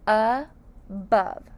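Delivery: "Above" as two syllables, a long gap between uh and bove; split into syllables. Shown as a demonstration. Teacher showing above has two syllables, split into syllables. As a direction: pause inside "Above" after the first syllable. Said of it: "Above has two syllables".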